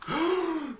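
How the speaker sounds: high surprised gasp; sharp gasp. Real, not acted. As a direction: gasp at the sight. Finding diamonds.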